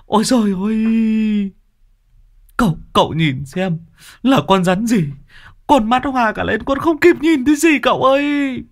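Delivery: high, wailing panicked voice of a snakebitten lad; panicked young man. Acted. Tâm wails to his uncle after a snake bite, panicked high voice.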